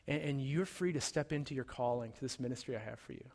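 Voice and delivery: soft, gentle, reassuring words to a broken friend; tender reassurance. Acted. Jesus restores Peter tenderly.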